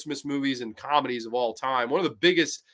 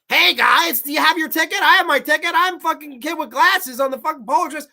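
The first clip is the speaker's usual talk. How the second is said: high, nasal-free mock kid voice, much higher and louder; mocking annoying kid; 13 semitones higher, 7 dB louder than usual. Mocking the kid with glasses from the movie, high and loud. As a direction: sneer at the kid by parroting him high and loud. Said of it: "the kid with the glasses".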